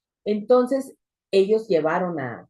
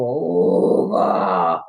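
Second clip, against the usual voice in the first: a retold demon snarling a name, louder; dark demonic voice; 3 semitones lower, 6 dB louder than usual. Demon snarling the pastor's name, retold to the congregation. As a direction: growl the name like an angry evil spirit. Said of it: "una voz tenebrosa, horrible ... enojadísimo".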